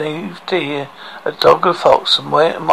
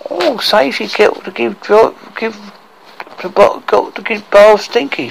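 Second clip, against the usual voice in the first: higher, eager dwarf voice; 6 semitones higher, 4 dB louder than usual. A narrator's reading. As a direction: pitch it up, piling on a demand. Excited dwarf adds a demand.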